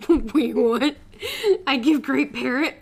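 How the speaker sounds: loud, baffled outburst; surprised. Acted. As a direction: burst out in confused disbelief. Confused outburst.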